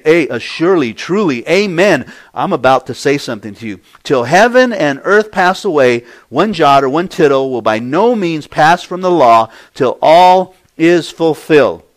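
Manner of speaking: forceful scripture delivery from the pulpit; emphatic declaration. Acted. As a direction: announce it with full authority. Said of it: "And Jesus is saying".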